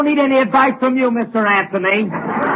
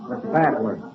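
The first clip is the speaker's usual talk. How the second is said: vault guard challenge; 9 semitones lower, 6 dB quieter than usual. Acted. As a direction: call out quietly as a guard in the dark.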